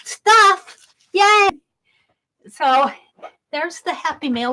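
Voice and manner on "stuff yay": high, loud gleeful squeal; playfully over-the-top joy at being sent stuff; mock excited. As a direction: squeal it like a kid getting a treat. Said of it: "And I'm going".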